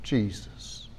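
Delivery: whispered name Jesus; soft unvoiced whisper; hushed. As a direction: breathe the name Jesus reverently. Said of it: "The first word that he says is".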